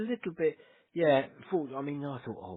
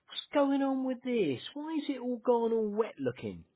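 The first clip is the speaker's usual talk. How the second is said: puzzled surprise; 8 semitones higher, 3 dB quieter than usual. Retold or imitated. Retold puzzled thought about wet screen.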